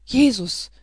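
high, loud cry of terror; sudden loud exclamation; frightened cry. A narrator's reading. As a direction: cry out expecting the fatal blow. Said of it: "rief sie".

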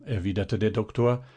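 ordinary delivery

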